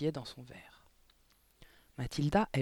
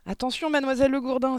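high, loud, quick warning; urgent high-pitched exclamation; alarmed cry; 10 semitones higher, 8 dB louder, faster than usual. A narrator's reading. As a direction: cry out to warn someone. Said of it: "s'écria Anémone".

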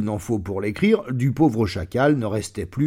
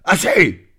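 loud, higher bark of a lion cutting in; sudden shout; 6 semitones higher, 7 dB louder than usual. Acted. Lion silencing the insufferable magpie, loud sudden shout. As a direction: cut the chatter off short. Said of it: "s'écria brusquement ce dernier".

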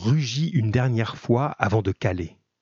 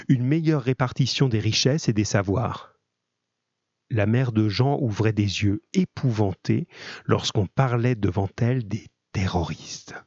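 plain reading voice

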